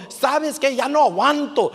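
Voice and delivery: high, loud acted outburst; imitated climber giving up. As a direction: play a climber at the limit. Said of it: "y dijo".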